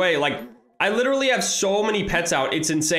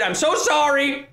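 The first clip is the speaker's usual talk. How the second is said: usual speaking voice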